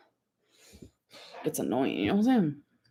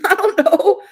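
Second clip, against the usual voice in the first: laughing it out, higher and louder; can't stop giggling; 10 semitones higher, 7 dB louder than usual. Real, not acted. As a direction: burst of real giggles through the words. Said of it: "I don't know why I'm laughing".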